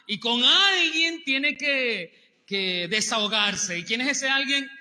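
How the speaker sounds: loud and high, slowed on the first words; emphatic preaching. Real, not acted. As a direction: lean hard into each word.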